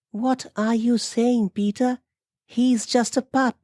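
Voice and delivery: high-pitched female character voice; high-voiced wife defending her pup; protesting wife. A narrator's reading. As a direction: object in a high, put-on woman's voice. Amelia defends pup to husband.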